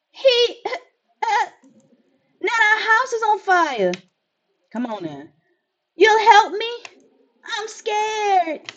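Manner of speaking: put-on high nervous child voice with a shaky laugh; a frightened kid asking for help; scared child. Child telling the player her house is burning, scared.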